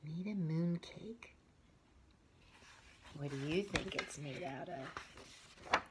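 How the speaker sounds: usual speaking voice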